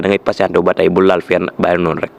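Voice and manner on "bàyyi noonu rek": usual speaking voice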